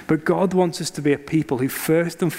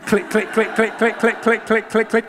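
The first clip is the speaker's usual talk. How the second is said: mimed ride clicks; 4 semitones higher than usual. Retold or imitated. Roller coaster climbing again.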